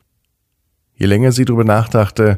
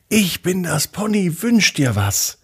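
high, excited pony voice; bubbly and wound up, high; talking pony; 10 semitones higher than usual. Acted. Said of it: "in einer Mischung zwischen aufgedrehter und, äh, doch aufgeregter Sprache".

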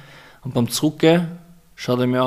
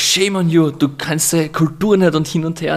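Stimme anheben, empört schimpfen; empörte Frau, laut und hoch; 4 semitones higher, 5 dB louder than usual. Retold or imitated. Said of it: "Sie so". (empörte Australierin schimpft laut über die Kultur)

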